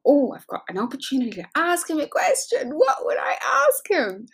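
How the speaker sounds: high, loud, giddy squeal; hammed-up thrilled inner voice; excited realization. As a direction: act out bursting excitement, pitch climbing to the end. Acting out her excited reaction.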